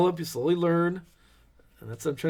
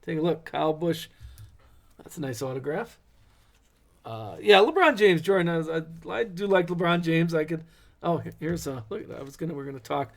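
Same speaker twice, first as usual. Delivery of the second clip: usual speaking voice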